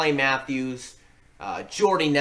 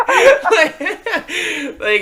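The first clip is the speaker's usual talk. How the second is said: high laughing burst, much louder; burst of laughter; 7 dB louder than usual. Real, not acted. Cracking up at Jurassic Park idea.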